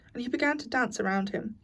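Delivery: usual speaking voice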